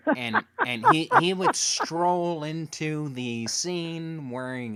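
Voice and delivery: drawn out, high and loud set-up; slow dramatic build-up. Real, not acted. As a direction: stretch it out to tease the reveal. Building up the entrance.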